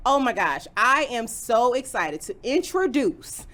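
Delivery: big hype host intro. Acted. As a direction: announce it like a show host. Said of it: "That's my intro voice".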